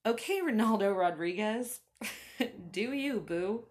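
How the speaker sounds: usual speaking voice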